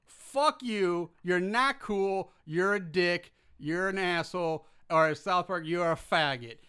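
furious podcast rant; angry rant. Real, not acted. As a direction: lay into the bass drivers. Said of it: "here is something we are not shouting out".

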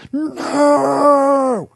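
long, high wailing scream; agonized scream. A narrator's reading. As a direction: scream out as your leg is snapped. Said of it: "as he screamed".

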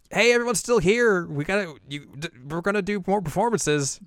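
high, loud cheerful phone call; excited call. Acted. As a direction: call it out with joy. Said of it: "girls who are like".

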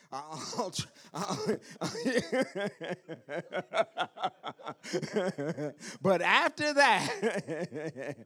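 real laughter through the words; slow, drawn out while cracking up; laughing. Real, not acted. Laughing at own church joke.

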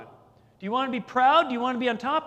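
the preacher pressing the question to Naaman; emphatic challenge. Real, not acted. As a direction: challenge them with "proud".

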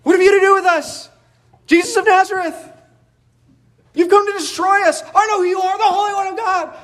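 possessed man screaming at Jesus in synagogue; screaming demon. Retold or imitated. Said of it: "he is screaming out at Jesus".

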